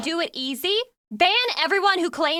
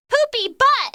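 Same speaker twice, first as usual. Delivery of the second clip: much higher, louder squeal of the words; silly shout; 11 semitones higher, 6 dB louder, slower than usual. Retold or imitated. Streamer mocking British humor, shrieking a silly insult. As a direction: squeal it like a naughty kid. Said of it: "go to Britain and say".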